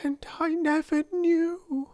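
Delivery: high, dismayed; mild woeful lament. A narrator's reading. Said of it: "she cried woefully".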